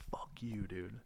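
under the breath, very soft; quiet self-talk curse; muttered curse. Retold or imitated. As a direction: mutter it down at your own feet. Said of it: "I go".

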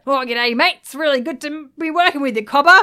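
loud, high broad Australian caricature; exaggerated Aussie. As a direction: ham up a hearty Aussie bloke greeting. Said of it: "the most Australian man on earth".

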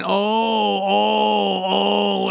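long, drawn-out mock wail; imitated crying moan. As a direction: mimic her drawn-out cries of "oh". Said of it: "She's crying".

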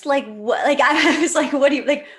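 high, loud laughing disbelief. Retold or imitated. Laughing in disbelief at her husband's sudden road trip plan. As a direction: laugh it out, can't believe it.

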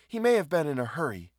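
mildly eager to help; helpful. A narrator's reading. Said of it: "she added helpfully".

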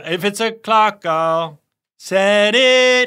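high, very slow hammed-up British voice; mock British accent. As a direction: stretch it out in a silly British accent. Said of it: "No, I think it was".

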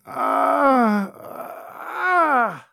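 high, drawn-out groans of pain; pained moaning. Acted. Bedridden innkeeper moans to the guard in agony. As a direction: moan as if near death. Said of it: "the innkeeper was saying".

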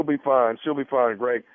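a lightly voiced, over-familiar physician; put-on doctor. Prankster doctor talking off the record, confiding.

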